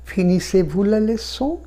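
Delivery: very slow, rising at leçon; inverted question. Shown as a demonstration. Teacher demonstrating the inverted French question. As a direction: put finissez first and lift the end. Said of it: "যদি inversion করি".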